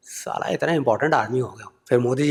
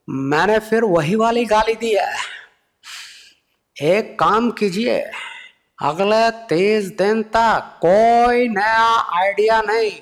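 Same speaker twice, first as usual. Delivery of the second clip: slow, higher, measured speech voice; Modi impression; 9 semitones higher, much slower than usual. Modi slowly banning the comedian's new ideas. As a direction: deliver it like a lofty address to the nation. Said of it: "फिर मोदी जी बोले".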